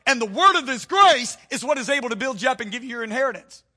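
loud, high, driving sermon climax; fiery preacher voice; preaching at full force. Acted. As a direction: hammer it home to the congregation.

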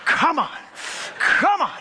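high, tense pleading; anxious urging. Retold or imitated. Nervous passenger urging the plane into the air, anxious urging.

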